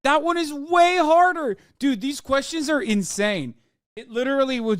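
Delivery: can't believe they asked that; incredulous outburst. Real, not acted. Streamer reacting to a quiz, high-pitched disbelief.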